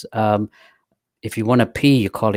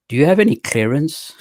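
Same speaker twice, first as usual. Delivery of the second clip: mock official; 6 semitones higher, 4 dB louder than usual. Retold officials questioning him.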